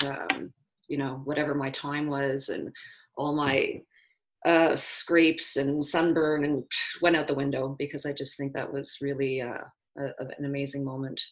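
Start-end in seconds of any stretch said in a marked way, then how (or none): none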